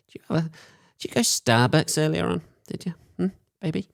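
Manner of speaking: playful put-on voice of a lover; mock lover's query. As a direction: tease a date about Starbucks breath. Voicing a partner in bed.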